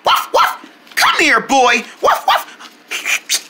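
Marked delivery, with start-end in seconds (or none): 0.1-0.9 high, short puppy barks; two quick high woofs; dog barking. Acted. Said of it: "Hi there, little puppy". 2.0-3.5 high, short puppy barks; two quick high woofs; dog barking. Acted. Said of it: "little puppy".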